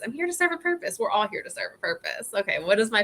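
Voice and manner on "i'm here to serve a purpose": same line lifted higher in pitch; higher repeat. Shown as a demonstration. Coach repeating her purpose, pitched up higher. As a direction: say it again up high, light.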